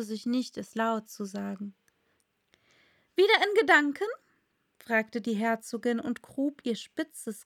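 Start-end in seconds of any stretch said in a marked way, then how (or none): 3.1-4.1 high, loud prying question; prying duchess. A narrator's reading. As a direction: poke at her with a sly question. Duchess speaking in story.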